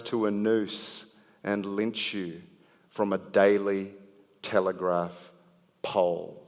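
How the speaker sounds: rhythmic slam poetry delivery with pointed pauses; poem recited to a live crowd with rising punch; spoken word poem. Acted. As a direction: perform it like a slam poet building to a sting.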